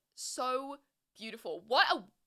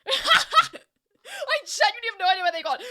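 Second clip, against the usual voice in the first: laughing through tears; 9 semitones higher, 11 dB louder than usual. Real, not acted. Reacting to emotional finale.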